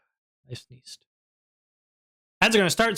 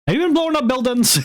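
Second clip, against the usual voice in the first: excited; 10 semitones higher, 3 dB louder, faster than usual. Acted. High fast mock accusation.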